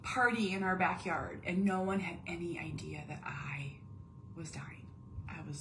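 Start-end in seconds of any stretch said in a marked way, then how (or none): 2.0-5.6 hushed confession; barely voiced, leaning close; whisper. Real, not acted. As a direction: whisper the secret out. Whispered confession of dying inside.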